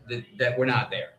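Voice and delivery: ordinary delivery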